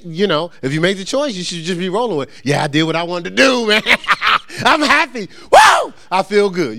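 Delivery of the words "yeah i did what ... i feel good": mock-happy boast with a shouted woo; hint of sarcasm. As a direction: fake glee, tongue in cheek, rising to a yell. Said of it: "a hinge of sarcasm there".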